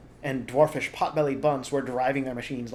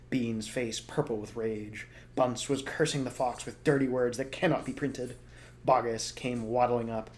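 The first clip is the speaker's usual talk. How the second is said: ordinary delivery, nothing marked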